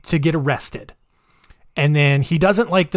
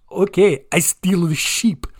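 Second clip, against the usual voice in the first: put-on Russian doing Chinese accent; 3 semitones higher than usual. Retelling Nikolai's fake Chinese act in a Russian-Chinese accent. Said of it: "how hard it is to do a Russian guy doing a Chinese guy".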